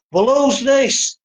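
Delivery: high, drawn-out retold challenge; mock officer's discovery. Officer confronting van about hidden fifth person, mock discovery. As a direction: imitate a suspicious officer, pitched right up.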